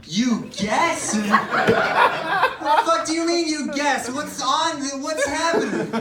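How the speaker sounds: high, loud, slow incredulous yell; panicked outrage. Retold or imitated. Comedian yelling at the nurse who only guesses. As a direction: yell it in disbelief.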